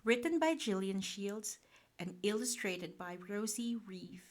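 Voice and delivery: usual speaking voice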